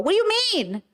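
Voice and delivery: mocking high-pitched whine. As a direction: throw the question back as a mock. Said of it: "it's kinda asking me, like".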